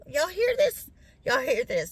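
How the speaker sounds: voice cracking from sinus drainage. Real, not acted. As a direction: voice breaking up high, throat full of drainage. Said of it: "Y'all hear how my voice is changing now".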